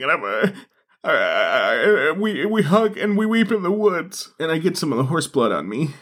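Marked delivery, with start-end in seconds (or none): 0.0-4.3 hammed-up sobbing through the words; mock weeping. Friends hugging in the woods after a scare, mock weeping. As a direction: blubber it, pitch wobbling upward. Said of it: "I start weeping".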